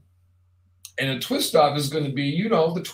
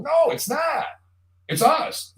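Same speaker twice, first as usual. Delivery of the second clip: emphatic rebuttal; 11 semitones higher than usual. Real, not acted. Rebutting a common claim.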